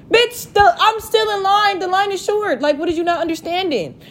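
high-pitched, indignant comeback; exasperated outburst. Acted. Fed-up woman answering his texts about the line, high exasperated protest. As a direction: pitch right up in disbelief and hold it there.

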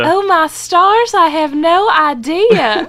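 high, slow, swooning belle drawl; exaggerated Southern belle. Co-host answering the host in an exaggerated Southern belle accent. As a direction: flutter it like a fainting Southern belle. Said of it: "I'm doing my best".

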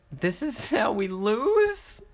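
high, slow laughing wail; laughing disbelief. Real, not acted.

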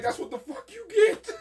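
high squeaky taunt through laughter; laughing taunt. Retold or imitated. Mocking the prank-pulling mom, high and laughing. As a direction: cackle the line at the mom. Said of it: "I was like".

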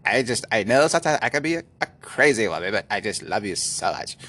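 imitating a gushing apology; mock-apologetic lover; apologetic girlfriend. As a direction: play a crazy girlfriend saying sorry. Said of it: "she's so apologetic".

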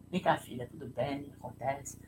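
soft, hushed reassurance; gentle comforting. Retold or imitated. Father comforting his daughter after the spill, soft and gentle. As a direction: comfort a crying child softly. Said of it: "eu acolho".

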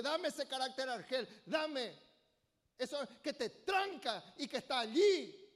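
God insisting, voice pushed up; calling for the stubborn heart to be handed over. Acted. God voiced demanding in sermon.

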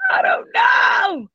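high, slow anguished cry out; frustrated wail. Real, not acted. Stumped, frustrated outburst.

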